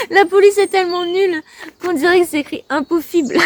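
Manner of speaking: high-pitched words through laughter; laughing. Real, not acted. Vlogger mocks her poem's font, laughing as she talks. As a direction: giggle helplessly while getting the sentence out.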